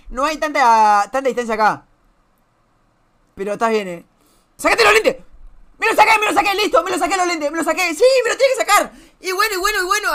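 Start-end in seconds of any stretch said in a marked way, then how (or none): 4.6-10.1 high-pitched rapid protest; worked-up, repetitive complaining; exasperated outcry. Acted. Exasperated reply to chat demand.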